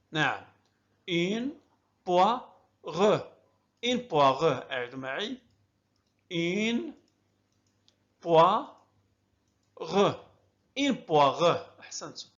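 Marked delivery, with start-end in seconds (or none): 1.1-2.4 "Une poire" in separate beats with short pauses; syllable by syllable. Shown as a demonstration. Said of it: "أقسم الكلمة إلى مقاطع رئيسية". 6.3-8.7 "Une poire" split up, long gaps for repeating; syllable by syllable. Shown as a demonstration. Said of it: "أقسم الكلمة إلى مقاطع رئيسية".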